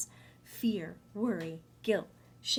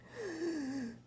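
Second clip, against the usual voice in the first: mock gasp; 9 semitones higher, 6 dB quieter than usual. Imitated gasp.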